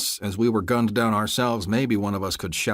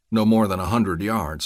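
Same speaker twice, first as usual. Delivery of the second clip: usual speaking voice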